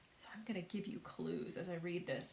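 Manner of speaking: whispering a hint to students; whispered aside. Real, not acted. Teacher whispering a reading tip to her students.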